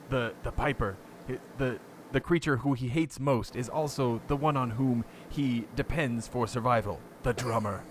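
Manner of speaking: hammed-up British wildlife narrator; terrible British accent. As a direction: narrate gravely in a badly faked British accent. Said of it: "here's my terrible British accent".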